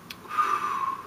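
long shaky-hearted exhale; real heavy sigh; emotional sigh. Real, not acted. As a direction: breathe out to steady yourself. Getting emotional over friend's loss.

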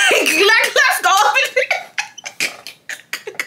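high, slow sing-song coaxing; drawn-out teasing call. Retold or imitated. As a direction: coax him in with a mocking sing-song. Teasing him, sung out.

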